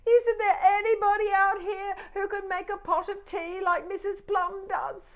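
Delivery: high loud pleading teapot. Acted. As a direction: call out hopefully down the road. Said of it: "she said".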